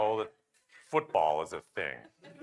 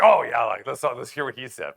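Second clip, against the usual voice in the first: loud, higher burst of sudden agreement; mock enthusiasm; 4 semitones higher, 12 dB louder than usual. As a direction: act suddenly won over by his idea. Imitating colleagues suddenly keen on man's idea.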